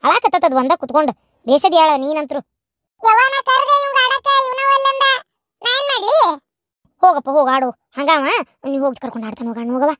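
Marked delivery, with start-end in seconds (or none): 3.0-6.2 put-on much higher childlike voice; child's complaint. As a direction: whine like a little boy defending himself. (ಅಜ್ಜಿಗೆ ದೂರು ಹೇಳುವ ಮಗುವಿನ ಎತ್ತರದ ಧ್ವನಿ)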